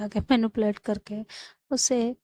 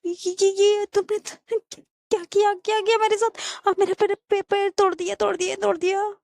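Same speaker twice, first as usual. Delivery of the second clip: very high, panicked wail without much volume; hammed-up cry of hurt; acted screaming; 10 semitones higher than usual. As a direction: stammer out a shocked, pained accusation. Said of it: "Sarika एकदम से चिल्लाती है".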